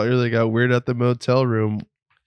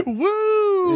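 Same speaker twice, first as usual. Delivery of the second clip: very high club "woo!"; imitated high-pitched cheer; high whoop. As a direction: let out a high party whoop. Acts out cheering in club story.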